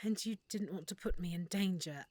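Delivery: soft whisper, moved; whispered, deeply touched. Acted. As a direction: breathe it out, touched by the care. Touched by adventurer's concern.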